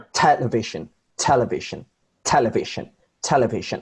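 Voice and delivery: "television" with "TEL" hit hard, then falling; "TEL-evision", strong start and falling end; first-syllable stress. Shown as a demonstration. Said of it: "I put stress on television".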